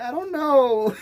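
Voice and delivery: helpless friend. Retold or imitated. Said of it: "She's like".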